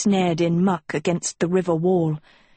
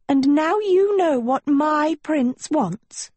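slow, cutting, pitched up; high icy jealousy; 9 semitones higher, slower than usual. Acted. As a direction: let each word sting. Sophie jealous, cold.

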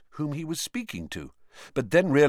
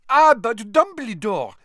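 loud, very high interjection; exclaimed protest; 13 semitones higher, 8 dB louder than usual. A narrator's reading. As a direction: cut in with a high, loud objection. Madame Maxime protests to Dumbledore.

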